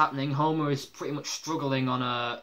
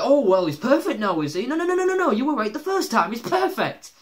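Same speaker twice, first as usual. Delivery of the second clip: very high, loud mocking rant; mock sarcastic outburst; 10 semitones higher, 9 dB louder than usual. Imitating Homer answering Marge's praise of Ned, sarcastic outburst. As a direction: pile on exaggerated, indignant sarcasm.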